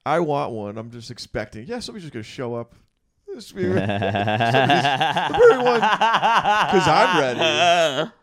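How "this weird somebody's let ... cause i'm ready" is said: laughing. Retold or imitated. Mock pleading to the universe.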